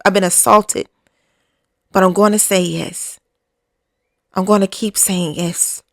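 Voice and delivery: ordinary talk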